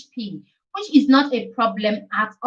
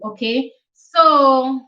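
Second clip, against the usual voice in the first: ordinary talk, nothing marked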